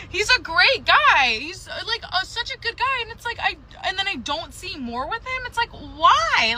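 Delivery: fighting back emotion over a good guy; getting emotional. Real, not acted. Woman telling her viewers about a boyfriend she can't feel for, getting emotional. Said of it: "I don't know why I'm getting emotional".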